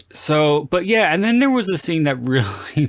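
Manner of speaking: normal talking voice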